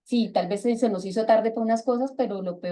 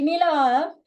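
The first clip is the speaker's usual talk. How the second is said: mock contempt; 6 semitones higher, 4 dB louder than usual. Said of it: "con desprecio".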